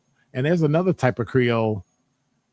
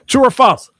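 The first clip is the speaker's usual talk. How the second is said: 1 loud call; 11 semitones higher, 10 dB louder than usual. Real, not acted. Quiz host calls out question.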